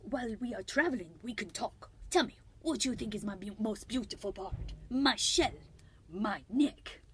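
hammed-up French accent, preening; Escargot. French snail fishing for compliments on his beauty, very bad French accent. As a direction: admire yourself in a silly, bad French accent. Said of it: "a very, very bad French accent".

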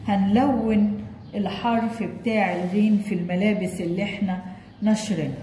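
ordinary talk, nothing marked

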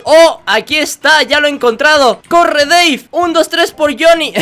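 high, loud hyped-up yelling; rally your buddy into the fight; excited shouting. Real, not acted. Gamer spots Johnny and urges Dave on, excited yelling. Finds target, rushes in.

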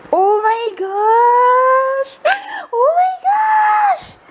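long, drawn-out high squeal; thrilled squeal. Real, not acted. Vlogger squeals over an unboxed gift, thrilled.

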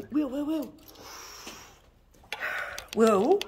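loud urgent call of a name. Real, not acted. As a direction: call him back sharply. Calling her son urgently.

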